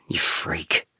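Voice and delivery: soft whispered you freak; whispered jab. A narrator's reading. Nikki teases J K about feeding the dogs, whispered. Affectionate insult.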